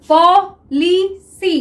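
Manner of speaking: Policy stretched very slowly, each syllable held; drawn-out word. Shown as a demonstration. Teacher showing students policy, very slow. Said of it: "teacher drawing out the word".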